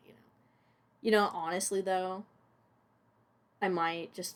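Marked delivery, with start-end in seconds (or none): 0.1-0.2 breathy whispered little laugh; whispered aside. Real, not acted. Aside after joke.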